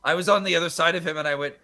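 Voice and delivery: ordinary talk, nothing marked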